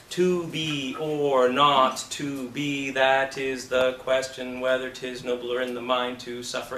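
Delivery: very slow, drawn-out syllable by syllable; just syllables. Shown as a demonstration. Coach demonstrates memorizing by sounds, slow and syllabic. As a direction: stretch each sound evenly, without intention. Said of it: "you're memorizing sounds. They're just syllables".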